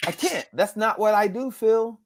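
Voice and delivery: high-pitched mock protest. Voice actor refusing colleague's dare to do impressions, protesting.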